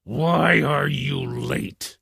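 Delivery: slow demanding lion question; a mild roar of impatience; lightly voiced lion. A narrator's reading. Lion questioning the late rabbit, slow. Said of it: "The lion roared and said".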